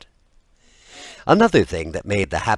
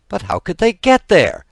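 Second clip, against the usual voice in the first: astonished parents, much higher and louder; 12 semitones higher, 8 dB louder than usual. A narrator's reading. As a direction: cry out in disbelief. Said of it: "asked the astonished parents".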